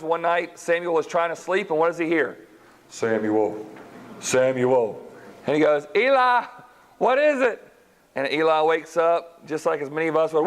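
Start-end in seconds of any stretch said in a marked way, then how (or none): 2.9-5.0 a low, distant call of Samuel's name; voice of God. Acted. God calling the sleeping boy, low and distant. 6.0-7.6 put-on young boy. Boy Samuel calling to Eli in the night, high and eager.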